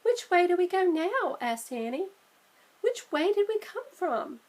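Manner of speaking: a touch of lost; lightly worried Annie. Acted. Sister lost in the blizzard, mildly anxious.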